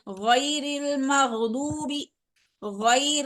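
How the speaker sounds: गैरिल मग़दूबी very slow and careful; slow model reading. Shown as a demonstration. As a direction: draw out गैरिल मग़दूबी for the student. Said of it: "मुझे सुनिए अच्छे से". (बहुत धीमे गैरिल मग़दूबी)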